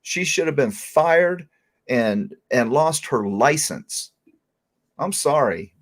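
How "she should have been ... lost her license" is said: slow, higher, each word weighed; emphatic. Real, not acted. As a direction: insist firmly and slowly. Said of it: "insists on firing".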